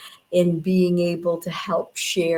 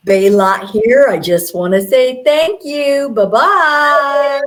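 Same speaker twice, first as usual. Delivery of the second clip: channeled voice; 5 semitones higher, 11 dB louder than usual. Acted. Channeled voice signing off.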